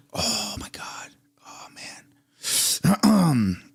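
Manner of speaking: stunned, tearful whisper; holding back tears. Real, not acted. Reacting to opioid death toll, holding back tears. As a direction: whisper it, close to crying. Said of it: "actually holding back tears right now".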